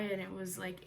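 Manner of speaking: ordinary delivery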